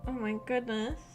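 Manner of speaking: ordinary talk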